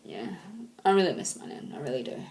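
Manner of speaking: quiet, tearful, sinking away at the end; real crying. Real, not acted. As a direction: grieve softly for your nan. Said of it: "sorry, crying again".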